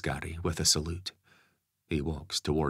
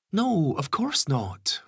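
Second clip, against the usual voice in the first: higher mother's voice, slow, insistent; insisting mum; 11 semitones higher, 5 dB louder, slower than usual. Acted. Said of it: "Lisa insists".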